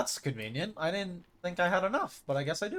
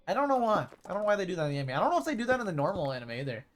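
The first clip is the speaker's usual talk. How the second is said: ordinary delivery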